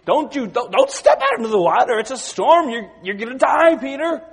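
high, loud panicked pleading with Peter; frantic friends shouting a warning at Peter; alarmed disciples. Retold or imitated. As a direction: beg him not to step onto the water. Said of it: "and they said".

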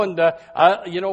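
usual speaking voice

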